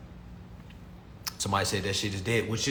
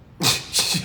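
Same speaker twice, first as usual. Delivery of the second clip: laugh; 2 semitones higher, 6 dB louder than usual. Real, not acted. Laughing at own remark.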